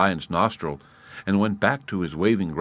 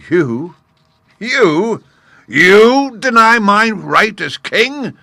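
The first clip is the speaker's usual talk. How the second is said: much louder, much higher furious roar; perfect fury; 12 dB louder, much slower than usual. A narrator's reading. Lion in a fury at the gnat, shouting. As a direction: explode with outrage, slowing on the last line. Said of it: "worked himself into a perfect fury".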